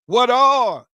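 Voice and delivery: high, loud preacher's shout; thundered question. Real, not acted. Preacher calls out his refrain.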